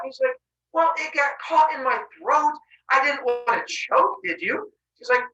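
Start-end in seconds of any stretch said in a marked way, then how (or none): 0.7-4.7 higher, slow mock innocence; innocent excuse. Husband excusing himself to his wife, slow fake innocence. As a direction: play it off innocently.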